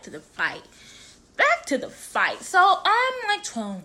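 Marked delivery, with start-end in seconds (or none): 1.4-2.4 much higher, playful announcement; high-pitched repeat. Real, not acted. Repeats it to resume story.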